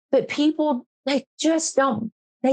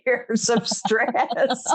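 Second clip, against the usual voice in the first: words spilling through real laughter; laughing; much slower than usual. Real, not acted. Host laughs telling her stress story, words through laughter.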